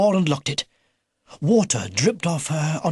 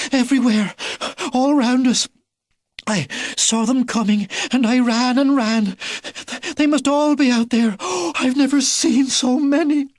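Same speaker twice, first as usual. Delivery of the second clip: frightened; 9 semitones higher than usual. A narrator's reading. Missus Badger telling Mister Edgar of the growlers, high and frightened. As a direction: tumble it out after running.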